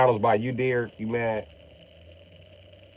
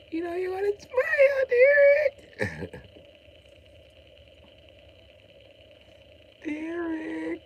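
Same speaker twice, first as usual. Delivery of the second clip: much higher, slow whiny falsetto; squeaky mock coaxing; much slower than usual.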